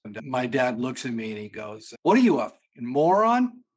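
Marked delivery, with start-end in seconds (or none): 2.0-3.6 imitating dad's loud, higher outburst; mock-furious father yelling at his son; retold angry dad. Dad scolds son over knives.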